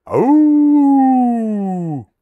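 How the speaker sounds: long, high wolf howl; sustained high "awooo"; loud wolf howl. Acted. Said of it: "howling loudly".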